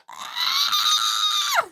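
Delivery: very high frustrated scream; shrieking scream. A narrator's reading. Millie when Steven can't help her, high scream. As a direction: let out a piercing scream. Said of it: "she screamed".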